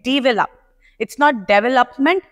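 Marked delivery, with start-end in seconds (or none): 0.1-0.5 develop with a short e, dih-vel-up; correct develop. Shown as a demonstration. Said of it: "इसको मैंने ई में sound कर दिया".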